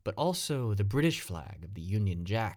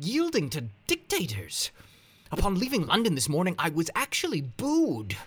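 exasperated protest; 9 semitones higher than usual. Acted.

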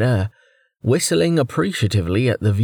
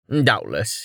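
ordinary delivery

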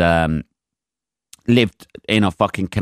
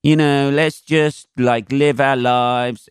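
slow, higher, spaced-out hippie drawl; laid-back hippie; 5 semitones higher, much slower than usual. Retold or imitated. Lightly voiced hippie from the audience telling him to live life. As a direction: imitate a chilled-out alternative guy. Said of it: "one of these alternative".

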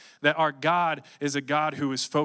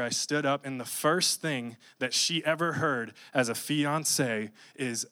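usual speaking voice

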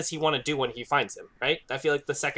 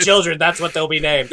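usual speaking voice

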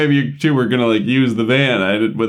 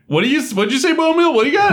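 much higher, straining to hear; shouting to hear; 13 semitones higher, faster than usual. Acted. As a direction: call out over the noise. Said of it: "He's like".

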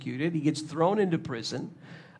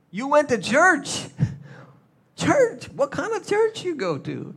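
much higher, louder, mock-shocked; mocking disbelief; 11 semitones higher, 6 dB louder than usual. Coworker ridiculing the believer's church visit, high mock disbelief. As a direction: squeal it like the idea is absurd.

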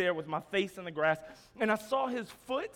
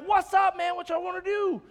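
loud, high-pitched street challenge; challenging yell; 9 semitones higher, 5 dB louder than usual. Retold or imitated. Yelling at guys running up on him, challenging yell. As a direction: yell it like you're daring them to fight. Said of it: "I started yelling at them".

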